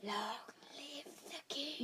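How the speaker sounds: slow, menacing movie-quote whisper; dramatic whisper. Acted. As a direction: whisper it, drawing out each word.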